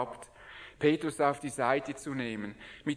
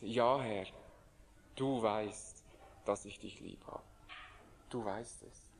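timid quiet answer; 7 semitones lower, 9 dB quieter than usual. A narrator's reading. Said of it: "antwortet nun ganz zaghaft".

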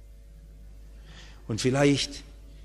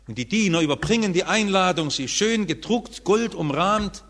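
normal talking voice

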